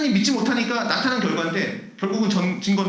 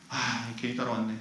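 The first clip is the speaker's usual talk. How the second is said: low, very soft regretful mutter; muttered grumbling; 11 semitones lower, 9 dB quieter than usual. Acted. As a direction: grumble under your breath, pitched right down. Acting out secret inner grumbling.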